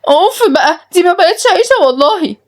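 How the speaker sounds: very high petulant whine; squeaky, acted exasperation; stamping tantrum. A narrator's reading. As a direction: huff and protest like a thwarted girl. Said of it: "روز بتنطيط ودبدبة في الأرض".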